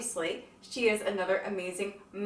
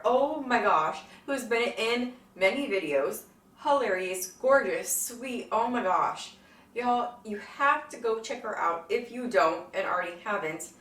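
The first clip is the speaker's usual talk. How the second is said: ordinary delivery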